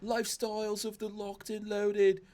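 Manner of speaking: high, plummy British TV host; posh British accent. Acted. Host imitates Robin Leach's show opening, posh British accent. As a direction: announce it grandly in posh British. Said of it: "You have to go posh".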